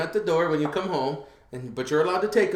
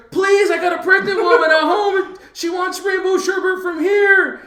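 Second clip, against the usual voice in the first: high, loud pleading shout; begging the clerk for sherbet for his pregnant wife, loud and high; desperate plea; 8 dB louder than usual. Retold or imitated. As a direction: beg like your life depends on it. Begging clerk to open door.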